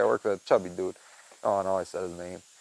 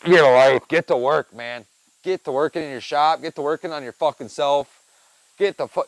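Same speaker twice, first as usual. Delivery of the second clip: louder, higher exasperated rant; ranting call-out; 3 semitones higher, 7 dB louder than usual. Real, not acted. Griping about a lazy coworker, in a loud exasperated rant. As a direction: bark it like he could hear you.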